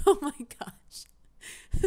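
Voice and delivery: squeezed out through laughter; helpless laughter. Real, not acted. Player watching a book used as a weapon, helpless laughter. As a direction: talk while cracking up, breath running out.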